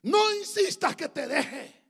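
high, slow, shouted declaration; Ruth. Acted. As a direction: cry out the refusal with full preaching force. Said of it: "Pero Ruth contestó". (grito solemne, alto y prolongado)

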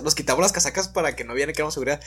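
usual speaking voice

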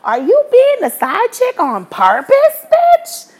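high, loud self-scolding; scolding herself. Retold or imitated. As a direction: yell at yourself in the mirror. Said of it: "I looked in the mirror and I said".

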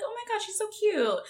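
high, soft fawning squeal; gushing. Retold or imitated. Viewer reacting to Vula's new look, gushing. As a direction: coo it high and softly, adoringly. Recalls gushing over a character.

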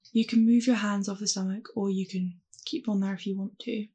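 calm, unhurried meditation voice; guided meditation. Acted. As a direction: guide them gently through the breath. Leads a mindfulness meditation.

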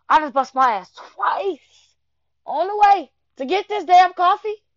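mock-furious rant about the spilled effort; exasperated scolding. Scolding a stubborn friend over coffee, loud and exasperated.

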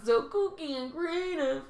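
high mocking singsong; mock the kooky girl.

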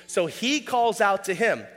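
usual speaking voice

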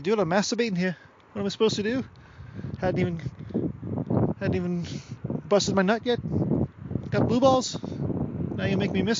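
high-pitched imagined golfer complaint; mock whiny protest. Golfer complaining to the friend who left, mock protest. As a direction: play an aggrieved golfer, hammed up.

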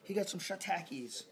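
high, lightly voiced commentator; a hint of a hyped announcer. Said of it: "And they'd be like".

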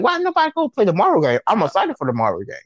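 high, fast, outraged question; indignant disbelief. Retold or imitated. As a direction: squeak out the protest in disbelief. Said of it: "And I was like".

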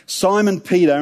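usual speaking voice

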